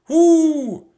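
loud, high owl's hoot; the owl answers Jack's question. A narrator's reading. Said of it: "said the snowy owl".

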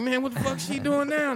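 high-pitched mock bewilderment; comic high-voiced disbelief; bewildered. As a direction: act out being baffled, pitch way up. Retold bewilderment during massage.

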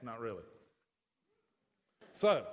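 normal talking voice

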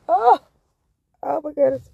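high loud startled cry; startled gasp. Real, not acted. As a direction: gasp it in alarm. Startled again.

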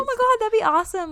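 squealing with delight; high thrilled burst; excited. Real, not acted. Excited at dog idea.

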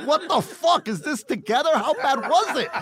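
high, laughing, incredulous outburst; laughing disbelief. Retold or imitated. Retelling the chick's coke den, laughing in disbelief. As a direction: crack up while asking in disbelief.

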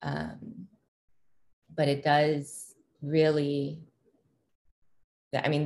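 usual speaking voice

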